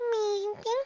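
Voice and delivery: high filly voice; a small doubtful maybe; young filly Dinky. A narrator's reading. Filly answering her mother, high and doubtful.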